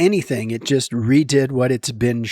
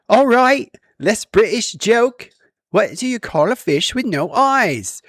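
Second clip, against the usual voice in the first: loud, high attempt at British; bad British accent; 9 semitones higher, 4 dB louder than usual. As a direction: have a go at a hammy British accent. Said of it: "I can't do a very good one, but I'm gonna try".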